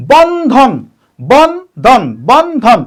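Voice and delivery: "বন্ধন" drawn into two beats, বন and ধন; split into syllables. Shown as a demonstration. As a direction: separate "Bandhan" after বন, stressing both halves. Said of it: "দুইবার উচ্চারণ করছি, দুইটা অক্ষর". ("বন্ধন" দুই অক্ষরে ভেঙে, বন-ধন)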